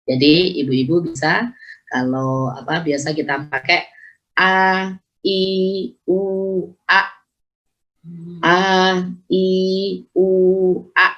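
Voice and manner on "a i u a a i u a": drilled "a, i, u, a", one sound at a time; pressed hamzah. Shown as a demonstration. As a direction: separate "a, i, u, a", each with a firm glottal attack. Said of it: "Hamzah mempunyai sifat yang naber".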